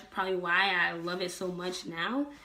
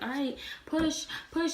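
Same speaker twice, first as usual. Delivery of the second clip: higher-pitched, drawn-out "push"; coaching; 8 semitones higher than usual. Retold or imitated. Dad coaching her to push, urging. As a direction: lightly imitate a birth partner coaching.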